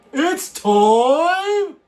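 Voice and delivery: very loud, high, drawn-out call; big hammed-up rallying cry; announcer's shout. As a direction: belt it out like a ring announcer. Announcer-style call to the stream.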